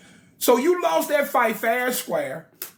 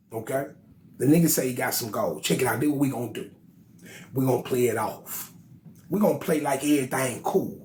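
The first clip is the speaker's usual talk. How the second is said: low scheming king; 6 semitones lower than usual. Acted. Anu scheming with counselors.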